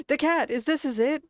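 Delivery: gasp, then high loud cry; startled alarm. Real, not acted. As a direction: blurt it out high after a gasp. Startled by cat in game.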